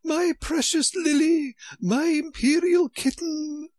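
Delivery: very high, loud doting wail; queen scrambling toward her kitten, wailing with love. Acted. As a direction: cry out to the child in a different voice.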